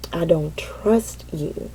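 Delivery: low, soft and slow; quoted accusation. Retold or imitated. Recounting son's hurtful words to her, quiet and slow. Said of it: "he said".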